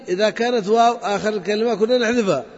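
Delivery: usual speaking voice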